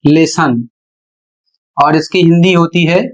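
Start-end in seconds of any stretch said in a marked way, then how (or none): 0.0-0.7 लेसन said as "lessn", the o not voiced; correct English pronunciation. Shown as a demonstration. Teacher showing the class the silent o, लेसन said lessn. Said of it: "इसका उच्चारण होता है".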